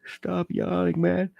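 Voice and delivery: a goofy alter ego nags the host for yawning; mock scolding voice. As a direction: bark at yourself in a silly voice, stop yawning.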